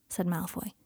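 ordinary delivery

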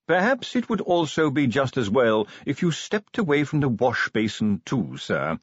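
nervous, tactful butler. Acted. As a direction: check delicately with the master, slowing at the end. Voices butler in story.

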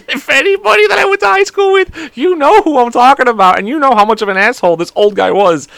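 real high, breaking-up laughter through the words; loud, high, cracked-up telling; laughing while talking. Real, not acted. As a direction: talk through a fit of laughter. Laughing at own story.

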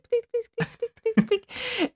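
high mouse-like squeak imitation; high squeaky noise. Imitating the squeaking heard in the dark kitchen, high and thin.

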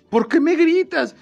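retelling a friend's complaint about yelling, as a high mock shout; mock indignant shout. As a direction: squeal it like a friend offended over exclamation marks. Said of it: "dijo un amigo".

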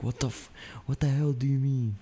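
acted-out argument, biting back profanity; catching a curse. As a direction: start to swear, cut it off, restart indignantly. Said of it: "I almost started cussing".

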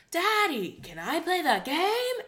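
put-on high, loud child-performer voice; hammed-up sing-song kid asking Dad; mock an over-projecting YouTube child; YouTube kid impression. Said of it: "you're doing a great impression of that".

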